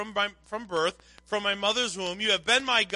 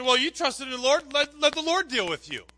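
higher-pitched mock taunt; jeer, pitched up to the end; ridiculing; 5 semitones higher, 3 dB louder than usual. Others mock his testimony of trust, ridiculing. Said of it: "Others were ridiculing his testimony".

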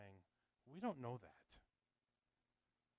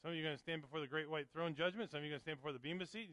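usual speaking voice

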